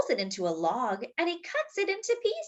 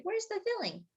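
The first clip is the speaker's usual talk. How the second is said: cat wondering; 10 semitones higher, 5 dB quieter than usual. Acted. Cat's thought voiced in story.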